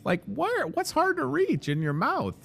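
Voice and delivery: high, incredulous questions; mock incredulous. Real, not acted. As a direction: scoff at the toothpaste box. Mocking the toothpaste claim.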